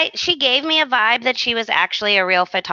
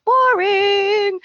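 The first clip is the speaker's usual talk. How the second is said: higher, drawn-out bored verdict; mock bored; 11 semitones higher than usual. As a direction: sing it out as a boring verdict.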